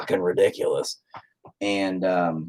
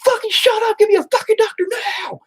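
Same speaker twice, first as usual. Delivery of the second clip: very high strained screamed demand; re-enacted scream. Father demanding a doctor for his son, high-pitched scream. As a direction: scream it out again as a desperate, furious demand. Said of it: "I screamed at him to get".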